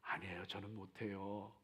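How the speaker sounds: meek humble refusal. Retold or imitated. Humble refusal voiced in story.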